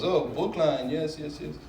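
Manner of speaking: security guard voice. Retold or imitated.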